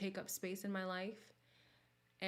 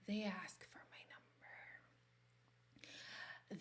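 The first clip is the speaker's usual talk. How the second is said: whisper; 11 dB quieter, faster than usual. Real, not acted. Whispered confiding.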